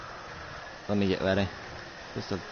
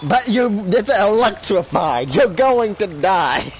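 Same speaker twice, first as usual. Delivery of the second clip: high, slow, drawn-out mock warning; teasing warning; 7 semitones higher, 4 dB louder, slower than usual. Real, not acted. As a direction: stretch out the doom teasingly. Warns friend jumping in water.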